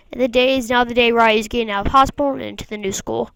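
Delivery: plain reading voice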